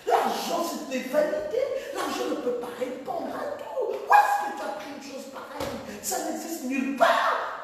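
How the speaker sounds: high laughing mock voice of the offended pastor; small voice. Pastor calling the sermon heresy, high mocking small voice. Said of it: "Il avait une petite voix".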